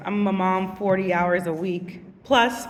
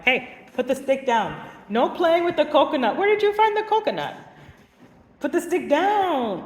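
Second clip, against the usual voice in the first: raised, higher scolding calls; stern playground teacher; 8 semitones higher, 3 dB louder than usual. Retold or imitated. Recess teacher calling out to kids, raised scolding voice. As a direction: call out to the kids like a strict recess monitor.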